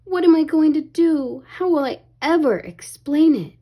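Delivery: worried princess lament; dismayed. A narrator's reading. Ariel despairs over lost pearl.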